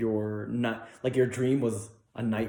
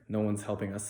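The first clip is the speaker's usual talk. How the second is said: normal talking voice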